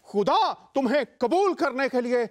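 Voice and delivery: preaching. Acted. Pastor preaching at full force.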